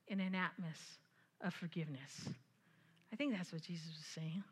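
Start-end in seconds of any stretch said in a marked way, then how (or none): 3.1-4.4 soft unvoiced whisper; whispered aside. Real, not acted. Whispered to the congregation as an aside. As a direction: breathe it as a private aside. Aside after emphatic phrase.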